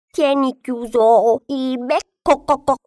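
high, slow scolding hen with clucks; clucking hen. A narrator's reading. Hen tells the duckling to shut up, clucking. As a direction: cluck the end like a cross hen. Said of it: "la gallina gli chiese ancora".

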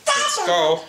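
high squeaky call; squeaky praising a dog. Real, not acted. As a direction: chirp it up to the dog. Calling the dog to bed.

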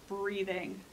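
ordinary delivery

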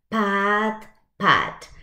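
ordinary delivery

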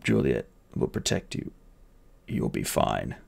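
a strong man's last weak words; comforting the boy while dying; dying bodyguard. Acted.

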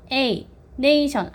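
"nation" with the first vowel A punched high; stress on A. Shown as a demonstration. Teacher shows English vowel stress, A stressed. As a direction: lean on the "na" of "nation", slowly. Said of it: "모음에 강세를 줘야 돼".

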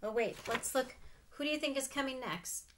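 ordinary delivery, nothing marked

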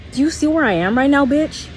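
high, loud and fast; triumphant shout. Real, not acted. Showing off her spot to the critics, loud triumphant yell. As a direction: crow it at the haters.

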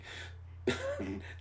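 a breathy amused laugh; light chuckle; soft laugh. Real, not acted. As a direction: laugh at the silly line. Reader laughs at own aside.

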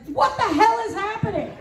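high, comic wail of panic; freaked out. Retold or imitated. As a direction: retell total bewilderment as a high-pitched cry. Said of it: "I was freaked out".

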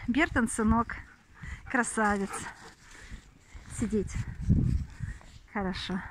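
low, hushed praise for a good dog; soft praise. Real, not acted. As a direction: murmur it gently to the dog.

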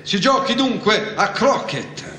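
high, imperious proclamation; thundering command. A narrator's reading. Queen orders croquet to begin, thundering command. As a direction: proclaim the game open. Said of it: "con voce tonante".

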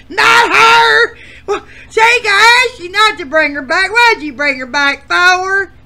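high, loud indignant shout; angry complaint. Acted. Contestant yelling at the host about the granny, angry. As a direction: protest at full volume.

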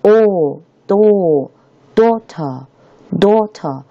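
long back "aw" building into British "daughter"; effortful British "aw", drawn out in "daughter"; British back vowel. Shown as a demonstration. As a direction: push "daughter" back in the mouth as slow "daw-tuh". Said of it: "它发音就很靠后".

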